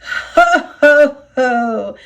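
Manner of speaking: amused engine chuckling at a rival. A narrator's reading. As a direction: laugh at Diesel's mess. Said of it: "chuckled Duck".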